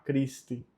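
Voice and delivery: "Christi" said KREES-tee. Shown as a demonstration. Teacher demonstrating the CH rule, said as K. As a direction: open "Christi" on a K. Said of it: "O C com H tem som de K".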